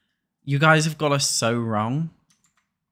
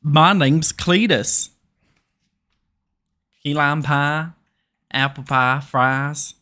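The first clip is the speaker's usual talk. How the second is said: mock Southern drawl on food words; lightly put-on American South twang; a hint of a Southern accent; 2 semitones higher, 4 dB louder, slower than usual. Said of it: "How good's my Southern accent?".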